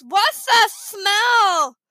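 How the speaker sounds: loud, high, very slow cry through a held nose; stuffed-nose voice. A narrator's reading. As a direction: pinch your nose and wail in disgust. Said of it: "they had the worst colds".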